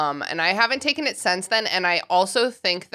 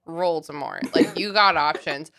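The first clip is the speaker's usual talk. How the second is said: ordinary talk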